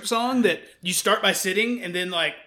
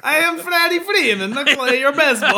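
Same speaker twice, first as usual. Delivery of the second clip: put-on Borat accent; 7 semitones higher, 7 dB louder than usual. Joking on a podcast, a high, loud, hammed-up Borat accent. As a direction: ham up a Borat-style Eastern European accent. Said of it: "It's Borat all of a sudden".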